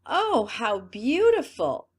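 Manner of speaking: loud, slow cry of wonder; exclaiming. Acted. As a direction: gasp it out in fake rapture. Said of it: "they all exclaimed".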